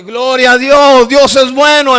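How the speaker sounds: loud, slow shout of praise; retold shout. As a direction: proclaim it at full volume. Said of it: "Entonces me gritó el hombre".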